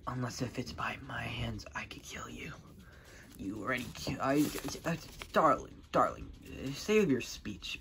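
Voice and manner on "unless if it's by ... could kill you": unvoiced threatening whisper; villain whispering a threat; menacing whisper. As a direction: breathe the threat quietly.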